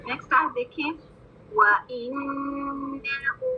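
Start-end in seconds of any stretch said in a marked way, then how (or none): 1.5-3.5 व इन्नहु very slow, ghunna held two counts, हु stretched; slow tajweed recitation. Shown as a demonstration. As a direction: chant व इन्नहु with rounded व. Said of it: "again ख्याल रखें".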